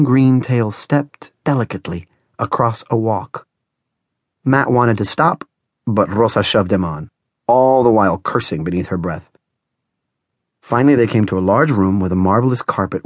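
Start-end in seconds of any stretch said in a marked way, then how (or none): none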